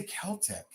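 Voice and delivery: "Celtic" as KEL-tik; hard K starting "Celtic"; hard-K pronunciation. Shown as a demonstration. Seller confirming her own way, "Celtic" with a K. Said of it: "I say Celtic".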